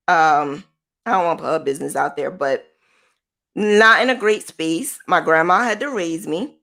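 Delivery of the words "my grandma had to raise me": ordinary delivery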